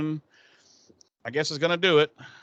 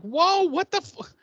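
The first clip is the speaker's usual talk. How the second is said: high, loud, shocked "whoa, what the hell"; incredulous outrage; shocked reaction; 5 dB louder than usual. Retold or imitated. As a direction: react like you can't believe what you just heard. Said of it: "And I'm like".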